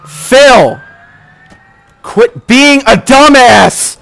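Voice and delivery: loud, slow "Quit being a dumbass!"; angry yell. Real, not acted. Gamer yells at teammate Phil, angry yell. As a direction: bark at a useless partner. Yelling at dumb AI partner.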